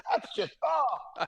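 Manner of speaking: high-pitched, hammed-up revulsion; mock disgust. Player imitating a rival repelled by his breath, mock disgusted. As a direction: recoil from a foul smell, half laughing. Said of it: "he was so disgusted".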